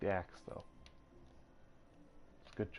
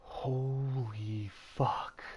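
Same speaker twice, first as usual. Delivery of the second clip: stunned, drawn out; 5 semitones higher, 3 dB louder, much slower than usual. Real, not acted. Reacting to a high price.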